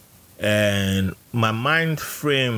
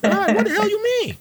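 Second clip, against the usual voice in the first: outraged retort. Retold or imitated. Recalling snapping at recruits about lions, squeaky high outrage. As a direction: yelp it in mock outrage. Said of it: "I'm like".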